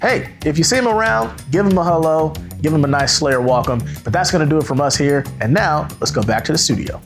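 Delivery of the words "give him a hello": normal talking voice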